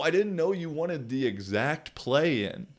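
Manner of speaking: sneering imitation of the coach; mocking the coach.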